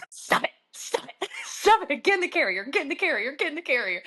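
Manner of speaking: high, breaking into laughter as she pleads; frantic laughing scold; laughing pleas. Retold or imitated. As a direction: giggle through the commands to the cat. Whacking cat into carrier.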